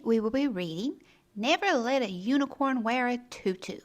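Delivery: usual speaking voice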